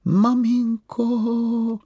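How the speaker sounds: vysoký, pomalý, protáhlý dětský hlas; tiše a dlouze zavolej maminku; zašeptal. Acted. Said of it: "zašeptal Mumínek". (Mumínek v noci tiše volá maminku)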